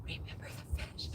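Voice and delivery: quick, hushed whisper; conspiratorial whisper. Retold or imitated. Daughter turning to mom at the funeral, quick whisper. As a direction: whisper it fast so only one person hears.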